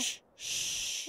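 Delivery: urgent whispered shush; librarian shushing whisper. Acted. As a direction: hush someone sharply under breath. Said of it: "You're shushing me?".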